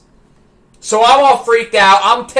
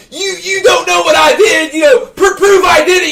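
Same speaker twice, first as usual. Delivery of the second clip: put-on kid's explosion; 8 semitones higher than usual. Boy yelling at the undercover cop, high angry shout. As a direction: blow up like a cornered eleven-year-old. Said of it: "I'm having an explosion over here".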